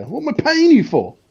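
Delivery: high, loud mock indignation; mock outrage. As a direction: play the furious team boss. Mock outraged boss demanding data.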